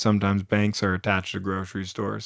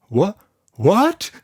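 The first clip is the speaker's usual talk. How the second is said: high, incredulous double what; shocked what; 8 semitones higher than usual. Acted. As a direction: squeak out a shocked what twice. Shocked receptionist.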